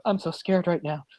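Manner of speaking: soft mock-tearful whimper; lightly faked crying. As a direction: imitate a tearful plea, hushed. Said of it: "she cries into the camera".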